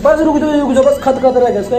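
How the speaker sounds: normal talking voice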